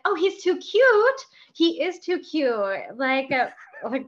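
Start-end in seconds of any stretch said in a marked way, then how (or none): 0.0-3.0 high, slow cooing over something cute; gushing. Retold or imitated. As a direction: coo it slowly like to a baby. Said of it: "I was like".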